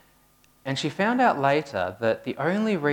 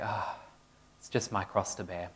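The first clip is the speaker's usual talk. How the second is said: softer, sighing 'it's just my cross to bear'; mock weary sigh; 3 semitones lower, 8 dB quieter than usual. Fan at the final siren, a soft mock sigh. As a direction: play the long-suffering fan with a sigh. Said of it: "Usually with a big sigh".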